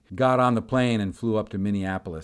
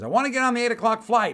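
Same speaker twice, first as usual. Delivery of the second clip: high, loud interjection; insistent demand; 12 semitones higher, 5 dB louder, faster than usual. Retold or imitated. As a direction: butt in, imitating a one-track-minded traveler. Joe butts in with demand.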